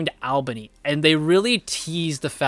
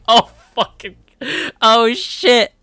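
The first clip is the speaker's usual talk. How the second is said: higher, louder alarmed outburst; shocked; 7 semitones higher, 6 dB louder, slower than usual. Retold or imitated. Viewer seeing Buck come out with a shovel, higher shocked outburst. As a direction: react like you just saw the shovel and saw. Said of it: "And I'm like".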